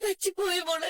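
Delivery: crying confession; sobbed, helpless regret; tearful apology. Acted. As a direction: plead for forgiveness through tears. Grandmother admits mistake.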